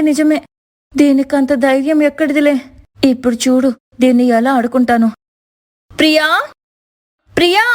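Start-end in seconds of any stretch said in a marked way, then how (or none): 5.9-7.6 calling out loudly. Acted. Divya calling her sister-in-law for coffee, loud call. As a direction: summon Priya across the house. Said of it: "గట్టిగా పిలిచింది దివ్య".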